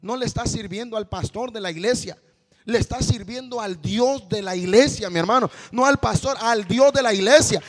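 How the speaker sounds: loud, rising preacher's climax; preaching at full force. Acted. Preacher telling quitters they serve God, not the pastor, in loud preaching. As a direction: build and shout it out.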